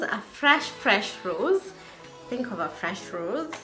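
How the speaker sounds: normal talking voice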